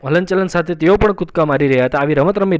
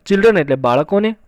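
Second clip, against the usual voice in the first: ordinary delivery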